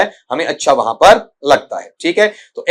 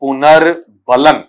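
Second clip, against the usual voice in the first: slow "पुनर्बलन", split as पुनर् and बलन; careful enunciation; 3 semitones lower, much slower than usual. Shown as a demonstration. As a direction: spell out "पुनर्बलन" by sound, taking your time. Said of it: "Hindi में हम इसे कहते हैं". ("पुनर्बलन" को धीरे, दो हिस्सों में बोलें)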